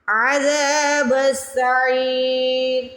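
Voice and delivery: عَذَابُ السَّعِيرِ with a throaty ain and long ذا; stretched ain and dhal. Shown as a demonstration. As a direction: recite عَذَابُ السَّعِيرِ slowly, drawing out ذا and عی. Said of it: "عین کی آواز نکالنی ہے". (عذاب میں عین کی آواز اور زال کھینچ کر)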